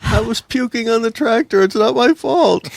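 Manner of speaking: high, slow whiny voice; a pitiful captive excusing himself; whiny hostage. Acted.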